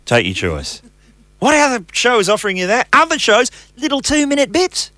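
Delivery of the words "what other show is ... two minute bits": high, hyped-up boasting; boastful hype. Real, not acted. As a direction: sell it like a salesman. Boasting about podcast length.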